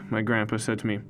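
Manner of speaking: ordinary delivery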